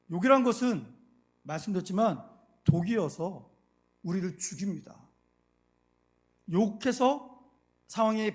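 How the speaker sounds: loud, emphatic sermon voice; forceful preaching. Acted. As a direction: preach it with conviction. (힘주어 외치는 설교)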